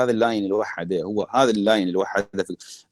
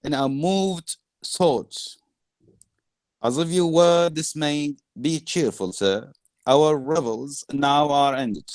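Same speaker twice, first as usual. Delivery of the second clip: slow, raised-pitch reading of a speech; dramatic verse recital; 5 semitones higher, much slower than usual. Acted. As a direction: declaim it slowly, word by word. Reads Prospero's speech to class.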